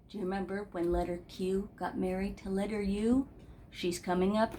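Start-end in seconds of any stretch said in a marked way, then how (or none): none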